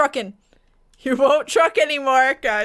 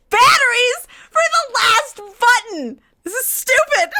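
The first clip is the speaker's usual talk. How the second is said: high, raised-voice whining shout; exasperated rant; 7 semitones higher, 3 dB louder than usual. Real, not acted. Player needing batteries for one press, exasperated shouting. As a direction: shout the complaint in disbelief.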